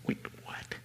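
a stunned, breathless whisper. Retold or imitated. Preacher reliving the call, stunned disbelief in a whisper. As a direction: barely voice the disbelief. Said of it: "I'm just like".

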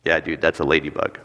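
low, deadpan brush-off; unimpressed adult killing the excitement; dismissive response. Acted. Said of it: "this is how I would have responded".

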